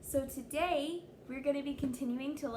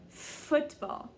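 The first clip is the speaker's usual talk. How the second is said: usual speaking voice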